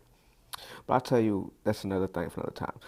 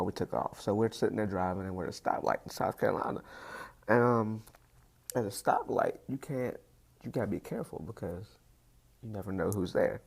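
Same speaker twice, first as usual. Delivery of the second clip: ordinary delivery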